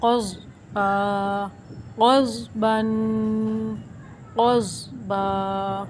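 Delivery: क़ज़ with a thick, heavy क़ाफ and ज़ाद; slow heavy Quran reading of क़ज़ बा. Shown as a demonstration. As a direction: make क़ज़ बा full and heavy in the mouth. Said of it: "इन दोनों को मोटा करके पढ़ना है".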